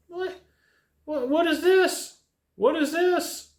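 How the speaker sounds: high, baffled mock disbelief; baffled what. Retelling the yelling woman, high baffled disbelief. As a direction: act stunned by a stranger yelling. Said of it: "I'm like".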